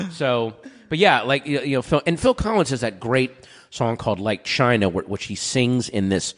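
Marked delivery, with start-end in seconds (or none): none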